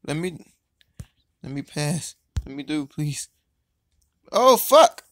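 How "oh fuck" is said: high, loud curse; frustrated yell. Real, not acted. Gamer stuck in last place, loud high frustrated curse. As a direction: burst out as the round goes bad.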